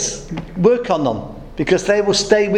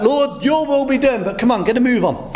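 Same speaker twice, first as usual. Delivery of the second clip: impatient pray-er; 8 semitones higher than usual. Retold or imitated. Mock impatient prayer to God.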